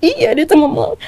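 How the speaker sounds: usual speaking voice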